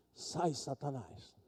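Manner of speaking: slow, soft mock rebuke; half-hearted exorcism; rebuking Satan. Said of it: "falei assim". (jovem repreendendo a moça, baixo e lento)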